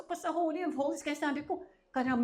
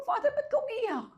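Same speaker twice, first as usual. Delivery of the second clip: high voiced question to God; 12 semitones higher than usual. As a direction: ask the Father gently, pitched high. Asks God in story.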